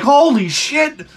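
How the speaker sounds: slow, high shocked gasp. Acted. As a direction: react to the giant hand. Mosquito sees hand.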